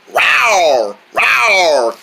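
playful falling rawr; pretend dinosaur roar. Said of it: "I'm a dinosaur".

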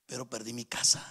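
low, near-whispered, imitating a man's painful admission; hushed admission. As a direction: let it drop almost to a whisper, long pause before repeating. Retold homeless man admitting his loss. (confesión casi susurrada)

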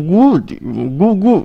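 very high squeaky "good, good"; mock mouthful answer.

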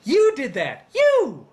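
high, punched accusation, falling off; mock accusing shout. Critic blaming the viewers, mock accusing shout. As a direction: accuse them with a hammed-up shout.

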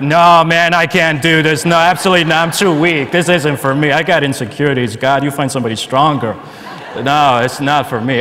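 loud, fast panicked self-doubt; anxious outburst. Retold or imitated. Recalling his own panic about the priesthood, loud and fast. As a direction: blurt out the fears in a rush. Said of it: "I'm like".

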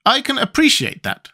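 high, sympathetic character voice; sympathetic Tin Woodman. A narrator's reading. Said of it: "said the Tin Woodman sympathetically".

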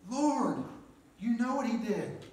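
high, loud, indignant shouting at God; a man arguing with God, shouting up at him in indignation; angry protest. Retold or imitated. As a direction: yell your case to the Lord, outraged. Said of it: "I kept arguing with God".

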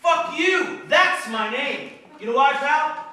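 very loud, high, angry retort; furious shout. Acted. Boss answering a salesman who asked his name, furious shout. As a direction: blast him for daring to ask.

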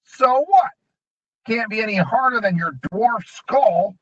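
high, loud jeering goblin. A narrator's reading. Goblin leader mocking the dwarf's thick skull, loud and high. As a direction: bark the insult up high. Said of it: "the goblin laughed".

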